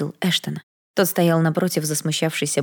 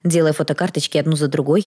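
usual speaking voice

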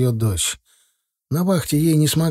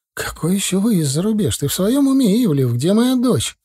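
high, shrill-pitched outrage; outraged; 8 semitones higher than usual. A narrator's reading. Mother hears of emigration and erupts at the ex, outraged. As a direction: explode at him in disbelief.

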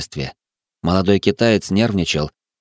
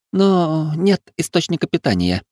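put-on higher-pitched voice of the girl; young woman character; 9 semitones higher than usual. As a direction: voice a girl's line, pitched well up. (женский персонаж, голос выше)

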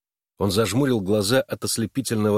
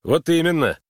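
loud, high-pitched irritated retort; short burst of irritation, pitch jumping up; 8 semitones higher, 5 dB louder than usual. A narrator's reading. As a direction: snap back in annoyance. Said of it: "раздражённо буркнул Харлан".

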